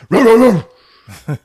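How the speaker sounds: slow, loud, high mock barks; dog barking. Doberman barks at Lisa, slow loud mock barking. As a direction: bark it out like a big guard dog. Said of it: "the Doberman is like".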